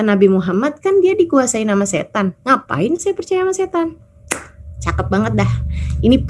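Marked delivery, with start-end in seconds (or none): 2.5-3.9 high-pitched, incredulous retort; dismissive rhetorical question. Retold or imitated. Wife explains ignoring an angry husband, dismissive. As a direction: wave the idea off with a lifted voice.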